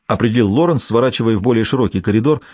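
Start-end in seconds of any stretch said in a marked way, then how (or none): none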